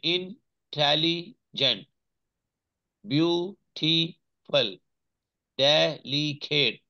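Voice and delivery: very slow "intelligent, beautiful, delicate", a pause after each syllable. Shown as a demonstration. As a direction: sound out "intelligent, beautiful, delicate" piece by piece for learners. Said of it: "दो से ज्यादा syllable वाले word". ("Intelligent, beautiful, delicate" एक-एक syllable अलग करके, धीरे-धीरे)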